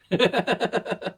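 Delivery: high burst of laughter; incredulous laugh. Real, not acted. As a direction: laugh it off in disbelief. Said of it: "laughing at brother".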